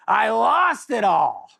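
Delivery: high, loud, drawn-out confession; mock loud announcement. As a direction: blurt it out big and slow. Retelling gambler announcing his loss.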